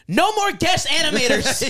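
high, slow mock announcement; lightly voiced boss laying down the law; Brett. S0 quoting Brett banning guest animators in a high voice. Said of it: "Brett was like".

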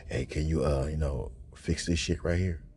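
mock request. Coworker asking him to fix it, low lazy voice. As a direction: mumble the ask low and lazy. Someone asks him for fixes.

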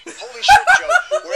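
high, loud outburst; exasperated shout. Acted. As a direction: yell in disbelief.

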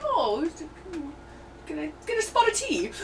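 high-pitched hammed-up British accent; mock British accent. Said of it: "I'm over here talking British".

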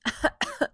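short, high pretend coughs; acted cough. Rooster among sleeping cows, pretend coughing. Rooster's sore throat in story.

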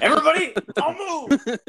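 mock robber yell. Acting out spoon bank robber.

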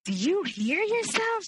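high-pitched, slow, incredulous challenge; acted indignant scolding. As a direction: imitate an appalled friend telling someone off. Friend scolds the boyfriend, acted out.

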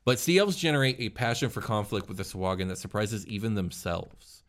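usual speaking voice

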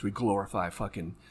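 ordinary talk

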